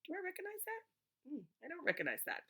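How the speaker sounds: high, wondering, then quick doubt; high puzzled musing; puzzled child voice. Pretend. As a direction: voice a child squinting at a word.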